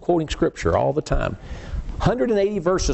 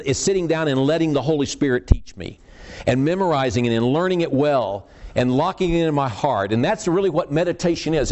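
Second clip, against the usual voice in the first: normal talking voice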